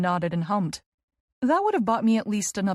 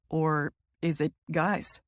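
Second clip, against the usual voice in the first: lower, slow, smirking tease; 4 semitones lower, slower than usual. A narrator's reading. Teasing brother probing her love life, slow and smirking. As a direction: let the smirk sit in the slow question. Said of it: "he adds with a smirk".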